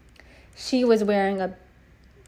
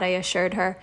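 ordinary delivery

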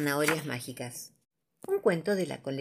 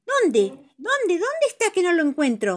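high, loud fretful character voice; frantic little voice looking around; anxious searching; 12 semitones higher, 5 dB louder than usual. Acted. Friend searching for the lost bear, high and fretful.

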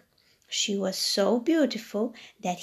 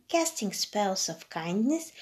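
usual speaking voice

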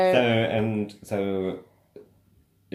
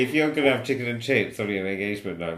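ordinary talk, nothing marked

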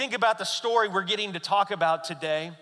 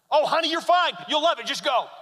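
high, louder cheerful urging; cheery dad; 9 semitones higher, 5 dB louder than usual. Retold or imitated. Dad urging his daughter off the slide, bright and loud. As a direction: egg her on brightly.

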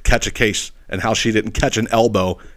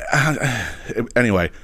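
usual speaking voice

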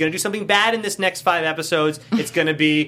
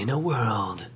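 movie trailer voice. Shown as a demonstration. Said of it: "my movie announcer voice".

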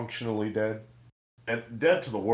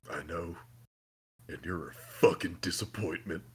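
low, soft mock Soldier Boy sneer; lightly voiced cold put-down, lower and softer; Soldier Boy quote; 7 semitones lower, 11 dB quieter than usual. Retelling Soldier Boy's insult to Homelander, low soft sneer. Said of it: "He's like".

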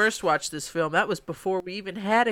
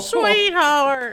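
high, drawn-out "Sweetheart"; character impression; 8 semitones higher, 4 dB louder than usual. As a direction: call out "Sweetheart" like the film character. Quoting the film's character.

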